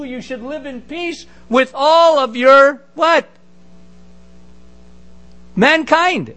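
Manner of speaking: usual speaking voice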